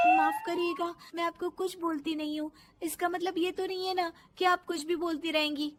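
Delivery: polite but wounded bahu; indignant daughter-in-law. Acted.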